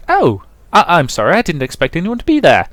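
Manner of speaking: high, loud startled blurt; startled apology. Acted. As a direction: jump in surprise and apologize fast. Man startled at the door.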